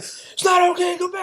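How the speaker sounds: high, loud mock police yell; imitating a cop yelling orders; retold screaming cop. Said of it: "So screaming".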